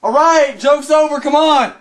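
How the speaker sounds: loud, high calling out; calling from far away. Real, not acted. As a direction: yell into the house. Calling for the hidden friend.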